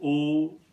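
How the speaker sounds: long, held O as in fool and shoe; long vowel. Shown as a demonstration. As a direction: draw out O like the vowel in "lose". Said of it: "Another long vowel".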